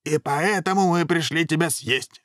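high-pitched threat; dragon head's cry. A narrator's reading. Second dragon head threatening to eat him, crying out high. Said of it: "закричал Пётр".

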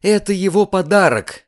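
high, loud, cross declaration; girl's indignant outburst; angry announcement. A narrator's reading. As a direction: throw it out angrily. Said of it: "сердито объявила она".

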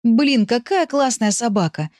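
put-on high, drawn-out boyish marvelling; delighted admiration. A narrator's reading. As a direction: marvel slowly at a wonderful dog. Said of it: "восхищался Андрей".

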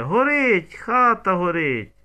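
high, drawn-out alarm wail. A narrator's reading. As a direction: howl it out as a storyteller's cry of alarm. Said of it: "як завиє".

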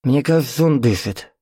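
lightly voiced agitated old host; blurting old man. A narrator's reading. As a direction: blurt it out at last. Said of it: "выпалил хозяин".

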